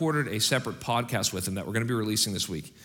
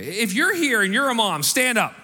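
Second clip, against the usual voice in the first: high, loud rally call; rally the moms to their feet; 11 semitones higher, 4 dB louder than usual. Real, not acted. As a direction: pastor calling the mothers to stand up. Calling moms to stand.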